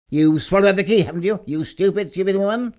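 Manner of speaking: high, loud, furious scolding; a husband berating his wife for swallowing the key, loud; furious accusation. Acted. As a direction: rage at her, loud and high.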